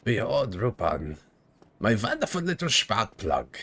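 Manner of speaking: put-on theatrical mad professor with German accent; hammed-up German-accented villain; crazy German professor. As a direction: gloat like a cartoon villain. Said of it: "Yeah, that's the voice".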